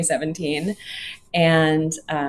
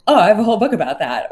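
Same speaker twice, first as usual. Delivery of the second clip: quick, louder mock-excited quote; eager poet's reply; 3 semitones higher, 7 dB louder, much faster than usual. Host quoting poets keen to join her reading, eagerly. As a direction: imitate someone jumping in eagerly, fast and louder.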